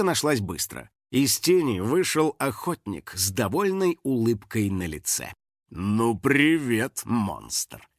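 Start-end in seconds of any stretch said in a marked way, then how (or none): 5.7-7.9 slow, low smug greeting; sneering. A narrator's reading. Hunter greeting his caged prey, sneering. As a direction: drawl it low with a smirk. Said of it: "с усмешкой бросил он".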